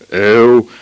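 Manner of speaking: loud burst of frustration from the father; exasperated shout. A narrator's reading. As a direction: let exasperation explode in one cry. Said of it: "råbte hans far og rev sig i håret".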